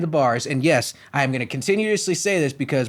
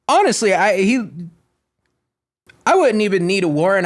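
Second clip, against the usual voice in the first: ordinary delivery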